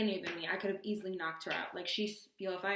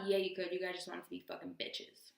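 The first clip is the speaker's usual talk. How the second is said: ordinary talk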